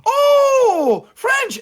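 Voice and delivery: very high, loud squeal of recognition; mock delighted shout. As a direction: imitate a thrilled crowd shouting in surprise. Mimics French players' delighted reaction.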